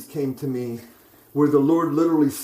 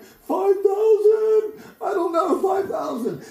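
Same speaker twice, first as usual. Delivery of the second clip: very high, drawn-out mock wail, easing slightly; imitated tearful cry; slower than usual. Overwhelmed man answering the prophet, imitated crying. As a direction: cry out a desperate guess, hammed-up. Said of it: "And I'm crying, I'm shaking".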